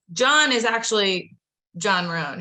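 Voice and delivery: usual speaking voice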